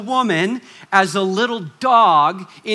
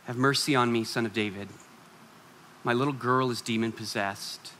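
much softer, lower, drawn in close; quiet intimate; 6 semitones lower, 7 dB quieter than usual. Acted. Preacher drawing the congregation close, quiet. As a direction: lower your voice and bring them in.

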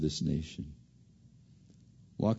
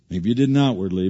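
ordinary delivery

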